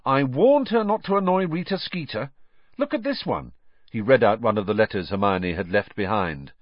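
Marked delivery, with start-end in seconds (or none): 0.0-3.5 much higher, lively I warned her; exasperated told-you-so; indignant. Acted. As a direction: Ron to Harry, exasperated. Ron repeats, more heated.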